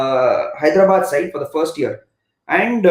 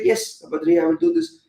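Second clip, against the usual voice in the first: light mock-polite reply, pitched higher; polite senior player; 10 semitones higher than usual. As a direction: play the dutiful, proper teammate, voice lifted. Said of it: "He'll be like".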